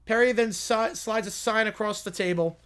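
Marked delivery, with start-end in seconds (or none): none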